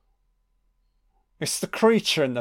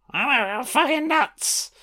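high, slow, unhinged wail; mock crazed; 10 semitones higher, slower than usual. Actress showing viewers her madness, hammed-up crazed cry. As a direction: go manic, pitched far above normal. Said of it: "I can do fucking crazy".

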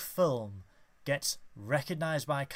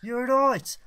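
high mock Brummie; Birmingham accent; 10 semitones higher, 7 dB louder than usual. Host riffing on redubbed action films, Birmingham accent. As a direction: pitch it up in comic Brummie. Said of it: "Arnold Schwarzenegger films and a Birmingham accent".

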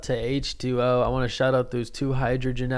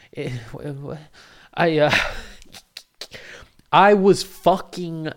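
laughing; 4 semitones higher, 6 dB louder, slower than usual. Real, not acted. Giggling about his excitement.

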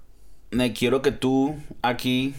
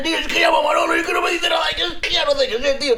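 put-on high, fast ranting old Spanish man; frustrated old Spaniard; faster than usual. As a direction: rant in a hammed-up Castilian accent. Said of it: "cuando es un español viejito que está como frustrado".

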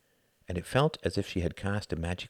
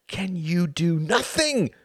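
slow, loud, higher enraged plea; enraged cry; 10 semitones higher, 5 dB louder, slower than usual. A narrator's reading. Tom crying out at his passive mother, enraged. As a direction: cry it out in sudden rage. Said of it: "cried Tom, suddenly enraged".

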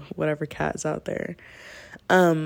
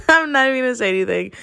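fast amused aside; 7 semitones higher, 6 dB louder, much faster than usual. Real, not acted. Laughing aside after awkward yes.

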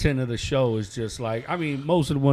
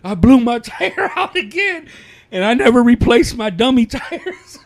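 high, louder, laughing through the quote; laughing retelling; 10 semitones higher, 8 dB louder than usual. Retold or imitated. Retelling friend's third blowout to cohost, laughing loud and high. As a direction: crack up while quoting him.

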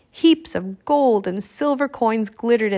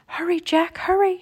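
higher-voiced little raven pleading for haste; urgent croak; 8 semitones higher than usual. A narrator's reading. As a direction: urge a friend on, pitched right up. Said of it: "she croaked".

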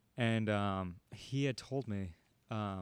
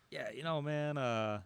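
hesitant, higher-pitched attempt at a Southern drawl; terrible Southern accent; 4 semitones higher, slower than usual. Reluctant storyteller imitating his fishing buddy, bad Southern accent. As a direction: have a poor go at a Southern accent. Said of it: "it's all said with the Southern accent".

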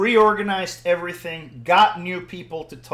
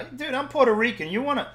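loud, higher-pitched mock challenge to a fight; fired-up street bravado; testy; 4 semitones higher, 7 dB louder than usual. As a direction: act out getting riled up and cocky. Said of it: "I'm getting testy now".

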